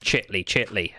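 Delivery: chitly with ch for qu; chitly like a small child; toddler chitly. Shown as a demonstration. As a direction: say chitly, ch instead of qu. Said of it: "where we say".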